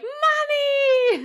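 put-on high, loud, drawn-out kid's cry; excited child voice. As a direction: imitate a child squealing for mom. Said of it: "when you walk in the door and they're like".

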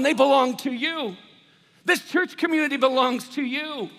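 high, loud preaching in slow, weighty phrases; impassioned surrender. Acted. Preacher giving family and church over to God, loud impassioned surrender. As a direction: proclaim it to God at full force.

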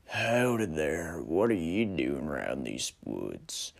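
slow, low frontier drawl; wary cowboy, sizing him up; western stranger drawl. Acted.

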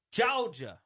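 loud, high put-on Georgia accent. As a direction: drop the R in a hammy Georgia accent. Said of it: "how awful your Georgia a-, accent is".

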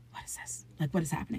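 hushed, voiceless aside; retold panic, whispered to oneself; whispered inner panic. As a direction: whisper it under your breath. Retold inner panic, cornered in bathroom.

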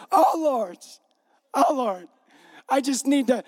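mock-reverent mom, lightly hammed up; pleading mother. Mother kneels before Jesus to beg a favor, pleading. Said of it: "So she's like this".

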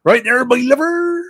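hammed-up English country-dialect greeting; local country accent. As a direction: play up the old country accent. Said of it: "that's how they used to speak".

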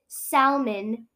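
"salmon" with a deliberate audible L; "salmon" carefully as "sal-mon"; L sounded in salmon. Shown as a demonstration. Defying the objectors, "salmon" with a clear L. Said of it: "I'm gonna keep saying it".

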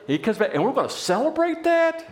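high, incredulous rising question; acted affronted older brother; indignant disbelief. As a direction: protest in outrage, pitch shooting up. Acts the resentful older brother.

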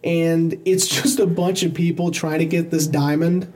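ordinary talk, nothing marked

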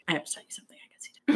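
hushed secret whisper. Retold or imitated. Sister whispers her big secret to her little sibling, hushed. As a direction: breathe it like a confession.

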